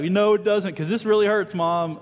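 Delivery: put-on kid's retort. A child answering mom during a whipping, loud and whiny. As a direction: talk back like a spanked kid. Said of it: "It's like".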